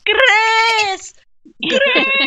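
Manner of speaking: high, drawn-out screech of a shout; imitating a loud, high-pitched yell; yelling a name. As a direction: holler it as if chasing someone. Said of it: "chasing behind you going".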